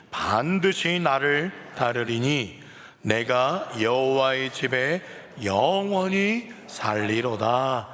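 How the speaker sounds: slow, loud unison scripture reading; solemn group recitation of a psalm; congregational reading. Real, not acted. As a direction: read it out together, slow and measured. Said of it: "한 목소리로 봉독하시겠습니다".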